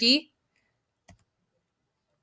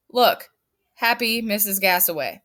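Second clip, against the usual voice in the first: normal talking voice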